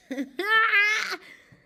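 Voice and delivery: loud high laugh breaking the tension; tearful laugh; sudden high laugh. Real, not acted. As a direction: burst out laughing. Laughs through emotion.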